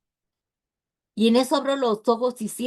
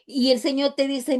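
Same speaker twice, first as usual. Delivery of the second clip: normal talking voice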